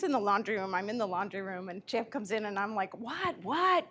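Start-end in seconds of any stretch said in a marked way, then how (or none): 3.0-3.9 high, rising what what; annoyed at the dog, pitched up; high exasperated question. Retold or imitated.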